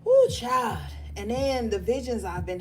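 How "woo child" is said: loud exclamation. Real, not acted. Exasperated exclamation to viewers.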